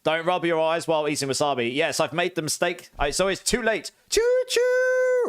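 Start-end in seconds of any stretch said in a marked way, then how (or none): 4.1-5.3 very high, loud train call; hammed-up choo-choo. Eating all six beans at once.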